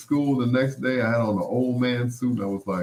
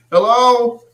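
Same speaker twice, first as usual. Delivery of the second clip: much higher, loud hello; dad's high voice; 12 dB louder than usual. Retold or imitated. Dad answering the home phone in a high, loud voice. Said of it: "My dad would get on the phone".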